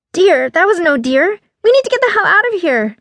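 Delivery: panicked, pitch climbing; scared urgent plea to flee; hoarse fear. A narrator's reading. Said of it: "she replied hoarsely".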